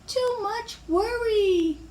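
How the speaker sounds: very high, drawn-out granny voice; slow grandmotherly lament; fretting grandmother. Acted. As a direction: stretch each word up high. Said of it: "said her grandmother".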